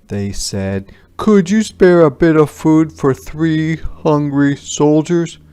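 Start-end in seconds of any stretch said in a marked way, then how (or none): 1.2-5.4 higher and louder, asking; begging soldiers. Acted. Soldiers asking Paul for food, raised pleading voice.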